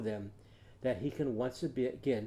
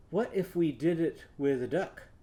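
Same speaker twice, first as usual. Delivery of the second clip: ordinary talk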